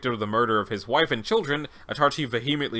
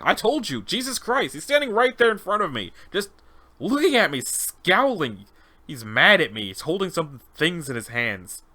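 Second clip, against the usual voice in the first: agitated test subject; 5 semitones higher than usual. Acted. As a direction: insist on the terrifying thing before you.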